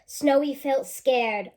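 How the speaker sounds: ordinary talk, nothing marked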